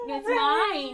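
guard the food playfully; playful possessive. Pretend. Teasing dog over food.

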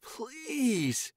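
high pleading cry; desperate begging. Acted. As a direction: beg for release. Tyon begging.

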